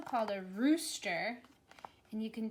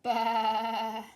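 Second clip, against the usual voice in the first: loud bleating baa like a sheep; 8 dB louder than usual. Shown as a demonstration. Teacher showing a child how a sheep says baa, loud bleat. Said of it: "try and make that sound".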